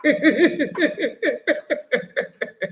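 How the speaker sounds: high, drawn-out giggle; real amused tittering, sustained; long laugh. Real, not acted. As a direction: laugh helplessly in a high run. Reacts to replayed clip.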